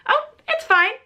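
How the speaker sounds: high, strained mock composure; holding back tears. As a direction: act like you're trying not to cry. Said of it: "trying so hard not to cry".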